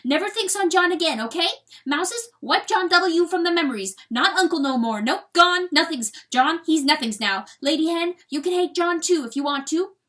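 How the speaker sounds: high, loud weasel outburst; agitated weasel, forceful and high; harsh. A narrator's reading. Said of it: "He said harshly".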